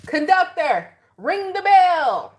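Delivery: high, loud, slow call for the bell; mock conductor call. Gemini calling to the train conductor, loud and high, slow. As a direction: sing out a grand order to the conductor. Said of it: "is what Gemini is saying".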